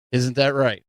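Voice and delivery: usual speaking voice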